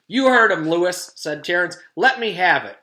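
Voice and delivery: loud, pushy claim; triumphant demand. A narrator's reading. Terrence telling Louis to hand over the prize. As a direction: gloat and demand at once.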